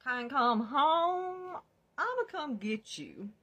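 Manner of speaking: loud, slow and high, put-on defiance; defiant. Replying to boyfriend he can't come home, loud defiant vow. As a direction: drag it out like a dare. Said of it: "and I'm like".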